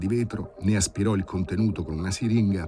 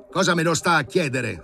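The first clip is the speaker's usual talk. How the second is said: much higher, louder, irritated snarl; 9 semitones higher, 4 dB louder than usual. A narrator's reading. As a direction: snap back at the assistant's question. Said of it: "ringhiò il professore".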